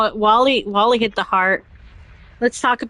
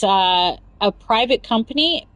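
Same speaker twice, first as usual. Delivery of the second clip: ordinary delivery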